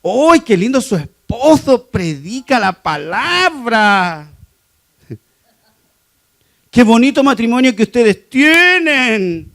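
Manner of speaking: slow, high, gushing praise; a churchgoer gushing over the pastor's marriage; admiring church lady. Retold or imitated. (elogio efusivo, lento y agudo)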